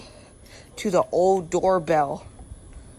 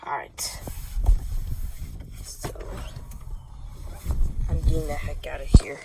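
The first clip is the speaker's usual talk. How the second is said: whisper; 4 semitones lower than usual. Real, not acted. Sneaking away.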